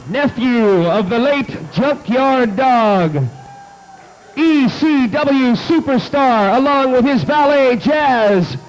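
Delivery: ordinary talk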